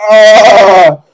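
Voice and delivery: loud high yelp of shock; pained scream. Pretend. Victim hit by the second spray, loud high scream. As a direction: let out a sudden hurt shriek.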